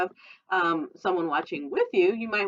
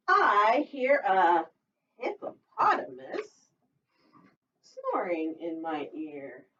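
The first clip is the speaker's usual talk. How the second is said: put-on sing-song read-aloud for little kids; storytime reading; 8 semitones higher, slower than usual. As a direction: read it like a lively picture book. Teacher reads picture book aloud.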